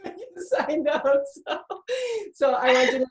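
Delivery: choked up, pushing the words out through crying; fighting tears. Real, not acted. Host moved to tears telling client she is loved, voice breaking. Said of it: "I'm trying again not to cry".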